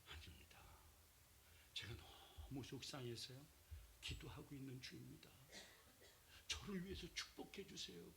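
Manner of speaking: soft, humble plea; meek reply. Acted. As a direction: answer quietly, holding back tears. Hannah answers Eli meekly.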